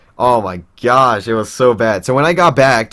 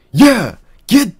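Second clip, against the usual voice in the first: high, loud retold yell; angry yell; 11 semitones higher, 6 dB louder than usual. Manicloud ordering him out, high angry yell.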